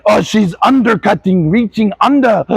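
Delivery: mock German accent. Coach mimicking other coaches' complaints, mock German accent. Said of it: "if I have a German accent".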